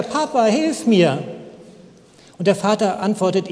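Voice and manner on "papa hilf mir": put-on higher, frightened little boy calling for his dad; panicked child calling. Boy hanging from branch calls papa, higher, frightened. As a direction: cry out to papa like a scared small child, pitched up. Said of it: "ruft er weiter".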